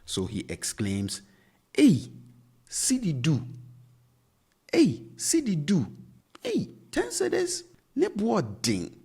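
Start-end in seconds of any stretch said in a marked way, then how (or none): 1.8-8.9 high, loud, shocked Hey, cedi du; much higher and louder astonished outburst; shocked exclamation. Acted. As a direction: cry out at the price in disbelief. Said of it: "Kwame is shocked at the price".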